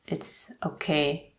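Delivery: normal talking voice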